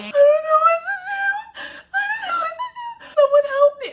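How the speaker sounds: high, wailing mock sob; a hammed-up crying wail. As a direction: whimper it out like a panicked student. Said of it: "I'm, like, crying".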